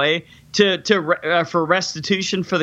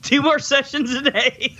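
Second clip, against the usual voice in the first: laughing repeat; 7 semitones higher than usual. Retold or imitated. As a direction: say it through a laugh. Repeats quote while laughing.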